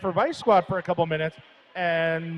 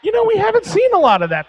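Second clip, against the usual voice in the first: excited; 5 dB louder, faster than usual. Real, not acted. Excited banter about short jams.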